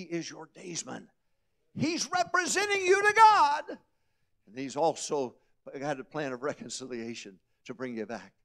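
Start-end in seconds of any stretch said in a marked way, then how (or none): 1.7-8.3 high, loud, ringing sermon climax; full-force preaching. Acted. Preacher lifting the congregation, full-force preaching.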